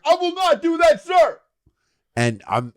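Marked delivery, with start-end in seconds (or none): 0.0-1.4 very high, loud refusal; hammed-up soldier refusing an order; mock soldier. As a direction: shout it like a defiant soldier in a game. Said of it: "playing the character from the game".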